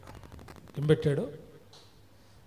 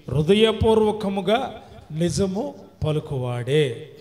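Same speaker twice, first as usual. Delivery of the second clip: loud, stretched-out preaching cadence; forceful preaching; 2 semitones higher, 7 dB louder, slower than usual. Real, not acted. Preacher expounds who may dwell in God's tent, forceful preaching. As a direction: draw out each phrase of the verse, projecting.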